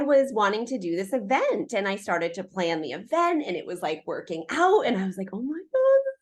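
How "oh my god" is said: much higher, thrilled oh my God; excited gasp. Retold or imitated. Recalling the event coming together, thrilled. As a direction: squeal it out in delight.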